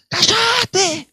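high, loud yell; shouted shut up. Retold or imitated. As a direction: bark it at the crying girl. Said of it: "el chabón decía".

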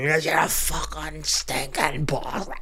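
slow, drawn-out mock Belfast rant; exaggerated Belfast accent. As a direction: stretch every word in hammed-up Belfast. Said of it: "you're not from Belfast".

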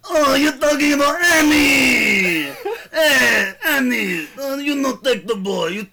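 put-on high, slow Toydarian accent with laughs; Watto impression. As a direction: wheeze it out like a greedy junk dealer.

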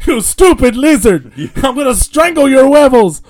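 play-acted enraged yell; hammed-up fury. Dropped guy threatening Rango, mock furious yell. Imitating character mad at Rango.

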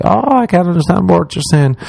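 high-pitched mock confusion; imitated Americans. Americans failing to understand an Irishman, high mock confusion.

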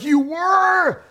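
high, loud mock shout of amazement; imitated boy's high-pitched disbelief; astonished kid. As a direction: shriek it like an amazed child. Acts son's amazed reaction.

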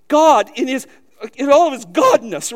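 high, loud burst of awe; fervent preaching. Real, not acted. Preacher marveling at God to the congregation, loud and fervent.

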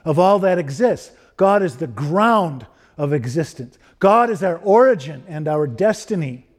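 measured, emphatic pulpit delivery; earnest preaching. Real, not acted. Preacher asks congregation if they are grounded in God, emphatic preaching. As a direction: preach it firmly, leaning on the key words.

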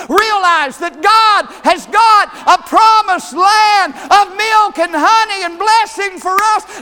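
loud, high shouted exhortation, slowing on key words; rally the church to take the promise; preaching at full force. Acted. Preacher shouting at the congregation, fiery and loud.

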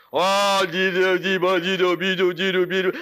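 loud, sing-song nonsense cooing; baby talk. Retold or imitated. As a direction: coo at the plant like a baby. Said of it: "talking like this to her kids".

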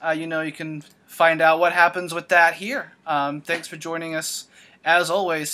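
upbeat host outro read; podcast sign-off. Acted. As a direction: wrap up the show like a radio host.

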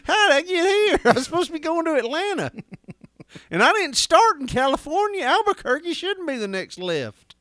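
high-pitched, hammed-up wail of a lost driver; mock bewilderment. Driver headed to Atlanta finding Albuquerque signs, mock bewildered. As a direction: protest in comic despair, laughing.

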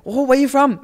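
high, loud, gushing mock question; curious stranger imitation. Someone asking the speaker where they are from, imitated high and loud.

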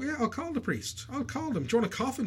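high mock-sweet voice humouring her. Husband mocking his wife who thinks she's dead, high mock-sweet. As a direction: make fun of her with a high sing-song. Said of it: "make a show of her".

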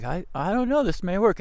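unsure. Retold or imitated. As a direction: say it unsure, voice lifting up. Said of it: "I was like".

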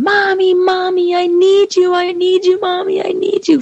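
put-on high-pitched pleading little girl's wail; imitated child's scream. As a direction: mimic a toddler screaming desperately for Mommy. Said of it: "she was screaming on the phone".

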